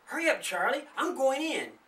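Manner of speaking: put-on high, pushy girl's voice; angry girl. Darlene urging Charlie to follow her in, high and pushy. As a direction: snap at him to hurry. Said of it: "she said angrily".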